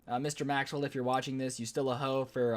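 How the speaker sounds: usual speaking voice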